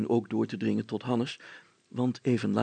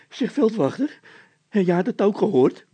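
high, loud, jittery man's voice; a nervous poacher calling out; frightened poacher; 10 semitones higher, 9 dB louder than usual. A narrator's reading. Bol, scared, calls to officer. (angstige stroper tegen de veldwachter)

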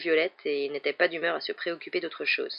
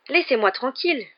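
high, insistent protest; raised-pitch demand to be let go; called-out protest; 7 semitones higher, 4 dB louder than usual. A narrator's reading. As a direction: throw it out while struggling free. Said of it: "lança Harry en essayant de se dégager".

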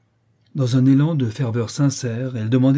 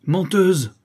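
high-pitched angry accusation; enraged cry; 8 semitones higher, 4 dB louder than usual. A narrator's reading. Countess calls her niece a liar, enraged. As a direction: hurl it out in a rage. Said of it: "cria la comtesse pleine de rage".

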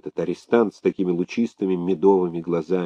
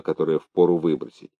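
usual speaking voice

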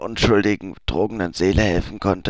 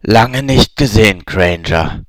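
a much louder, menacing greeting; rough voice; 8 dB louder than usual. Acted. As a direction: loom over her with a rough voice. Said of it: "sagte eine raue Stimme".

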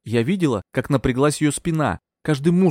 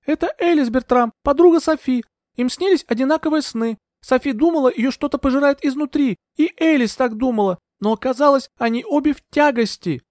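very high, agitated woman's voice; high-pitched, insistent plea to be believed; urgent explaining; 13 semitones higher, 3 dB louder than usual. A narrator's reading. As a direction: press your case in a high voice. Heroine urgently explains to doubters.

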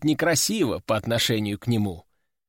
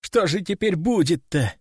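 higher-pitched mild wail of worry; worried wail; 4 semitones higher than usual. A narrator's reading. Lab assistants dreading what happens next, mildly wailing. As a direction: wail it lightly, fretting.

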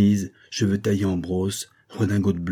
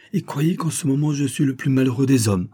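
higher-pitched, earnest distress; visibly upset; 6 semitones higher, 3 dB louder than usual. A narrator's reading. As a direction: protest it with real regret, as if shaken. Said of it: "visiblement ému".

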